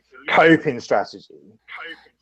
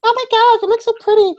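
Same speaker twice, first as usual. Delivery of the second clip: put-on girly voice; 3 dB louder, faster than usual. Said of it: "They're like".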